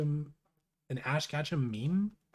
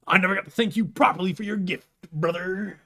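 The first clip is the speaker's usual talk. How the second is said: Hulk Hogan impression; 6 semitones higher, 8 dB louder than usual. Streamer reading Rose's line, louder Hulk Hogan impression. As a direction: boom it out like a wrestling promo. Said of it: "I can't do a good Hul".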